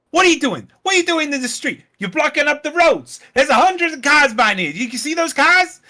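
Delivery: high, loud, fast indignant demands; imitating angry woman. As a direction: play the fed-up driver scolding police. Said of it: "'Cause she says".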